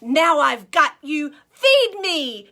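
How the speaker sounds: loud villain shout. Acted. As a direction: gloat loudly as the door slams. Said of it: "shouted Foxy Loxy".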